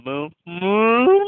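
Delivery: a loud, high mock cow moo; hammed-up cow moo; playful moo. Shown as a demonstration. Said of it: "you can do a nice little moo".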